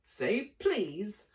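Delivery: high, coy taunt; teasing poltergeist. Acted. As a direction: tease him sweetly. Peeves teasing.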